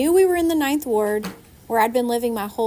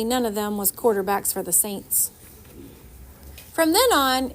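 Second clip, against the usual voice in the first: usual speaking voice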